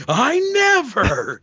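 high, drawn-out cry of indignation; hammed-up outraged protest; mock outrage. Quoting Gary's scene from the film, mock outrage. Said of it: "Gary gets so outraged".

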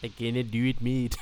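hammed-up Scottish accent; mock Scottish. Echoing the host's teasing, mock Scottish. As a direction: admit defeat in mock Scottish. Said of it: "Scottish appropriation".

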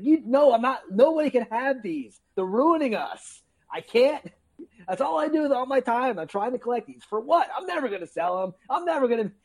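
high, frantic mock meltdown; card-collecting friend melting down, frantic and high. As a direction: rant like he's losing it. Friend losing it over cards.

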